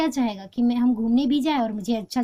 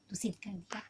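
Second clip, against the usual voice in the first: whisper; 3 semitones lower, 16 dB quieter than usual. Real, not acted. Teacher explaining proverb's meaning.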